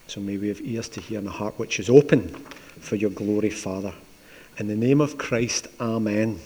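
soft, low reverent prayer voice. Real, not acted. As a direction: pray it quietly and humbly. Pastor prays before sermon.

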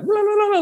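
high, sustained "la-la-la" babble; imitated running noise. Man shows how the boy ran, imitated high la-la noise. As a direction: trill out a goofy running sound, high up. It was just kinda like.

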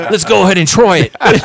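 high, loud mock-daredevil cartoon voice; hammed-up eagerness, shouted over laughter; Steve Irwin parody. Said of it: "like, South Park episodes".

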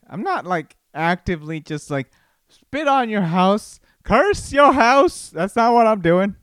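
rising indignant reply that ends near a yell; retorting to wife. Retold or imitated. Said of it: "And I'm like".

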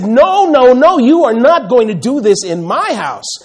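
Jesus angrily clearing the temple; angry rebuke. Retold or imitated. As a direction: drive them out with force. Jesus driving out sellers.

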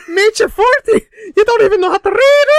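leprechaun on crack, shrieking Irish; Conor McGregor. Acted. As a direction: screech it loud and very high as a crazed leprechaun. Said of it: "leprechaun on crack, it's like".

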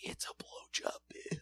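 hushed, breathless whisper; creepy whisper. Acted. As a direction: whisper it like the scary reveal. Creepy reveal of gift.

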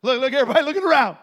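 quick, high, very loud outburst; loud teasing. Real, not acted. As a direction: blurt it out fast at the congregation. Calling out the congregation's reaction.

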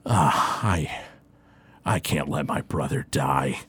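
low, soft, broken-up warrior voice; upset warrior. Acted. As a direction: hold back grief as the warrior. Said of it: "he looks really, really upset".

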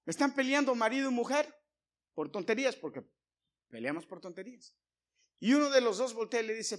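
loud, high sermon voice; preaching at full force. Acted. Preacher warning couples about fights, loud and fervent. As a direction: preach it with fire.

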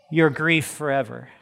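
normal talking voice

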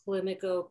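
Climaco said KLIM-ih-koh; pronunciation demo. Shown as a demonstration. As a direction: punch the short first syllable of Climaco. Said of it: "See what I mean?".

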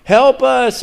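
high, slow pleading cry; crying out in prayer. Retold or imitated. Preacher voicing fans praying for Damar, high slow cry. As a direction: lift it up to God, high and slow. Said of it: "They're crying out".

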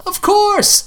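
high, loud chipper reply; suddenly bright and eager; perky. Acted. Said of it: "I immediately perked up".

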